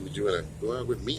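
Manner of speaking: fast, very soft mock plea; asking someone out. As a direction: mumble the ask out quickly. Mocking asking women out.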